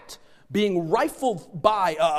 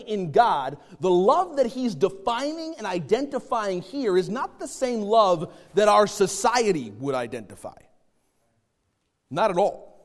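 raised, higher-pitched preaching delivery; emphatic preaching; 4 semitones higher, 4 dB louder than usual. Acted. Preacher separates God's love from society's love, emphatic preaching. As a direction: press the doctrine on the listeners with force.

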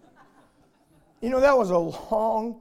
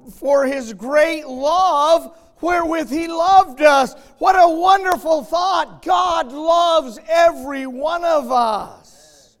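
slow, much louder and higher, stretched out; preaching at full force; 8 semitones higher, 11 dB louder, slower than usual. Acted. Preacher reads of God's great love to the church, slow booming shout. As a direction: proclaim the verse like a trumpet call.

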